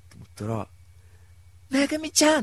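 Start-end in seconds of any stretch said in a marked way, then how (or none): 1.7-2.4 high, loud call of her name; surprised greeting. Retold or imitated. Seeing his crush by chance and calling out her name. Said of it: "って言ったら".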